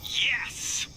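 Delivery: high-pitched, gleeful yes; delighted little outburst; excited cheer. Real, not acted. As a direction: celebrate with a jump up in pitch. Celebrates getting telescope parts.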